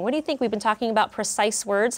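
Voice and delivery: ordinary delivery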